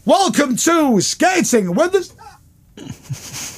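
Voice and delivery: very high, drawn-out show title; Vernon Kay. Retold or imitated. Vernon atop the stairs announcing the show, presenting. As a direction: stretch it into a huge TV announcement. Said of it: "and he goes".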